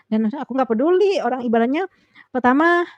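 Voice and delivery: normal talking voice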